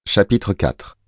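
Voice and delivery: ordinary delivery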